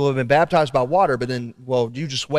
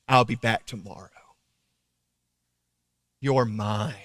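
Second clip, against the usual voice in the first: slow, soft, lower assurance; tender and sincere; 5 semitones lower, 6 dB quieter, slower than usual. Acted. Preacher voicing God to the congregation, softly and slowly. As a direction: speak it like a loving father claiming his child.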